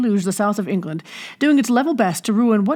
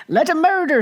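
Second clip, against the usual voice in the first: higher mocking statesman voice; lightly mock a politician, pitched up; mimicking Pitt; 9 semitones higher than usual. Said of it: "says Mister Pitt".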